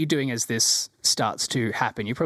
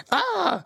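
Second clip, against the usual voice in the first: startled yelp. Acted. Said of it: "He goes".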